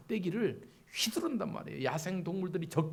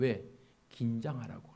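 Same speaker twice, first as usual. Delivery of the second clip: normal talking voice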